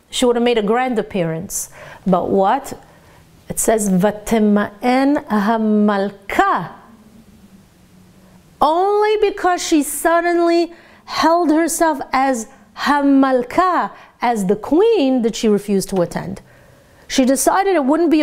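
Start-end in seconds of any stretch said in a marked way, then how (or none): 3.9-6.7 "Vatema'en hamalka" read slowly, leaning on "hamalka"; slow down and land on "hamalka"; teacher stressing "hamalka" as the key word; emphasis on hamalka. Shown as a demonstration. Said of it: "place the emphasis on the right word". 12.8-13.9 "hamalka" slowed and pressed with a falling tune; teacher driving home the word "hamalka"; stress on hamalka. Shown as a demonstration. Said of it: "place the emphasis on the right word".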